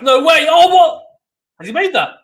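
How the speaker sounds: very high, loud yell of astonishment; real astonished commentator outburst; disbelieving shout. Real, not acted. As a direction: explode in stunned disbelief. Stunned by a live rally shot.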